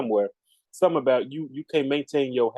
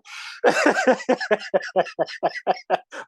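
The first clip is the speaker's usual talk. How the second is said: helpless burst of higher-pitched laughter; 5 semitones higher than usual. Real, not acted. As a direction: break into a long, real laugh. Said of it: "I'm laughing because".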